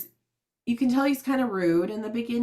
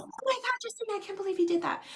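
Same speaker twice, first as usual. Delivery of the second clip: high, fast, breathy-free shocked mother voice, much higher; shocked mom; 12 semitones higher, 4 dB quieter, faster than usual. Retold or imitated. Mother scolding her daughter for swearing, high shocked voice. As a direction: gasp it out like a scandalized mom. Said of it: "my mom was like".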